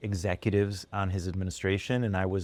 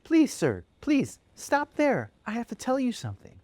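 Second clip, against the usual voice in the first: put-on sweet little boy. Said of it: "very sweet".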